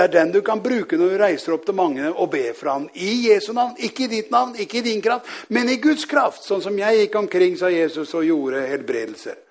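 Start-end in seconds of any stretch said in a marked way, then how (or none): 3.3-6.4 raised pitch, punching each contrasting phrase; emphatic contrast. Retold or imitated. Jesus tells preacher whose power heals, emphatic contrast. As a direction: drive home "Guds kraft" with a higher, firm push.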